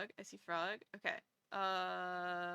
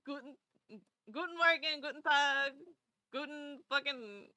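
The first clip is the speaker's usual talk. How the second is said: German phrases; 4 semitones higher than usual. Shown as a demonstration. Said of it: "German words I know were".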